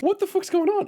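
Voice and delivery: very high-pitched mock bewilderment; retold alarm. Retelling mystery card charges, with hammed-up alarm.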